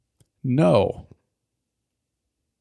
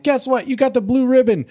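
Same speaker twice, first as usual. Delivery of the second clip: high, bright mock announcement; cheery announcer; 11 semitones higher than usual. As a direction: announce the happy news. Announcer quote, much higher.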